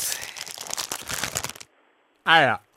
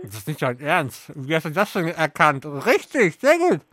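mouth full. Real, not acted. Host still chewing marshmallows.

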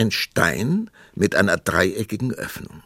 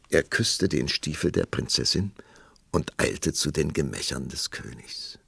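usual speaking voice

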